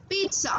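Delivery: pizza said as "peet-sa", with a ts sound; correct pronunciation. Shown as a demonstration. Teacher drilling the class: pizza as "peet-sa". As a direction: say pizza with a ts, not a z. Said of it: "okay".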